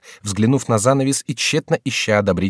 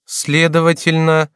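slow, raised, deliberate reasoning; musing aloud; 8 semitones higher, 5 dB louder, slower than usual. A narrator's reading. Duke reasoning aloud as if to himself, musing. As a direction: think it out slowly, out loud. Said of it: "как бы раздумывая сам с собою".

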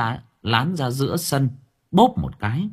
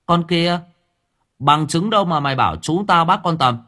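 loud, high accusing yell; angry shout; 6 semitones higher, 5 dB louder than usual. Acted. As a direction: bark it out at the girl. Dũng's family confront Lán. (quát to giận dữ)

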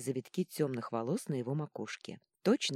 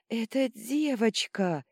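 slow, high, loud joyful cry; tearful outburst; 8 semitones higher, 7 dB louder, slower than usual. A narrator's reading. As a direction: announce it loudly, bursting into happy tears. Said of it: "вдруг разревелась Ванила". (радостный крик на грани слёз)

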